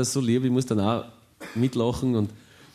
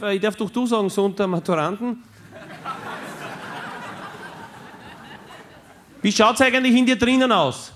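groundskeeper's voice; 11 semitones higher than usual. Retold or imitated. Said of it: "Und er sagt".

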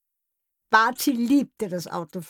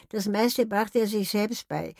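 plain reading voice

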